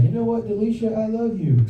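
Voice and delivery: high, lightly hammed-up love declaration; mock-earnest confession. Acted love confession on show.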